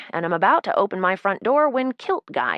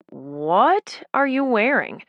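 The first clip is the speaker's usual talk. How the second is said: drawn-out, higher, louder blurt of disbelief; incredulous "What are you wearing?"; appalled disbelief; 6 semitones higher, 5 dB louder, much slower than usual. Acted. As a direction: blurt it out aghast, stretching the words. Blurts out shock at outfit.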